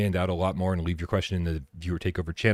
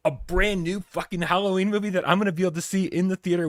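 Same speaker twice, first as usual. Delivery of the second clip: put-on high, thrilled teen question; excited disbelief; 12 semitones higher than usual. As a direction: gush like an excited teenager. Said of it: "and you're like".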